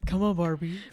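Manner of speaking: Ken voice. Pretend. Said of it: "With a voice like that".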